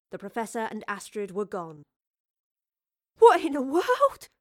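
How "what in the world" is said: high, loud, slow amazement; astonished cry, stretched out; laughing wonder. Acted. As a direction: exclaim in delighted disbelief. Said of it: "Thomas laughed in wonder".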